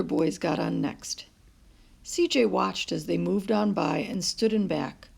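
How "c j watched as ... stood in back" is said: ordinary delivery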